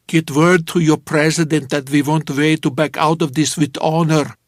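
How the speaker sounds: put-on a Soviet envoy dictating terms, Russian accent. George voices the Russian envoy to Sadie, in a Russian accent. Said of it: "here I did a pretty good Russian accent".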